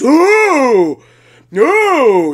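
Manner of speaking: loud mocking ooh, as if hurting someone. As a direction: jeer ooh at them like a playground bully. Mocking the offended audience.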